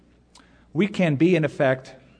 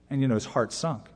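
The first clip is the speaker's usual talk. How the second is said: normal talking voice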